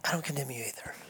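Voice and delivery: whispered gently, barely voiced; a hushed whisper of mercy; soft whisper. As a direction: whisper it like Jesus forgiving her. Jesus speaking to the accused woman.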